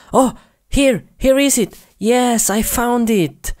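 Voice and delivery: high-pitched delight at a find; excited discovery. Acted. Player has just found it, excited. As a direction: exclaim it, pitch jumping up. Finds what he looked for.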